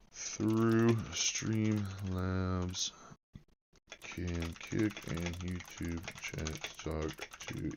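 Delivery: very slow, soft, word by word; slow absent murmur. Real, not acted. Streamer looking up whether chats can talk, slow quiet murmur. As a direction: dole out the words quietly as if typing them. Typing out a search query.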